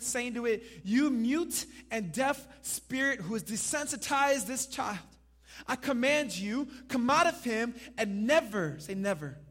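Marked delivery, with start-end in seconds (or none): 0.9-8.8 loud, raised-pitch order to a demon; commanding rebuke. Acted. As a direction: rebuke the spirit with full authority. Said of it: "he rebuked the unclean spirit".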